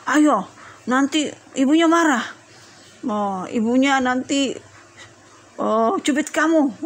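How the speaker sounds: mildly scaring a little sibling; acted warning of trouble; mock threatening. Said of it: "Jangan ditakut-takutin terus".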